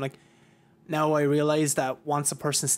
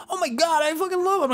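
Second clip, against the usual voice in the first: friendly rock star; 4 dB louder than usual. Retold or imitated. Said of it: "He goes".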